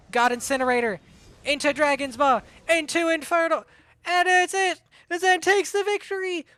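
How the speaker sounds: excited play-by-play. Real, not acted. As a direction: build up to the finishing call. Calling the match-winning combo.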